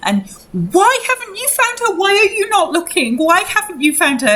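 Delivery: high-pitched, desperate pleading; distraught mother. Acted. Mother demanding search for daughter.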